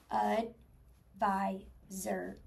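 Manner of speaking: ADVISOR very slow, ud, vi, zer, with gaps; chopped into syllables. Shown as a demonstration. Teacher counting syllables for the class, chopped apart. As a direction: break ADVISOR into three separate syllables. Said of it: "Chop it out. Here we go".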